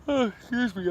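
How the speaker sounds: words stretched through a real yawn. Real, not acted. Talking to the chat mid-yawn, yawning. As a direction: yawn out the apology, high. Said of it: "I'm yawning".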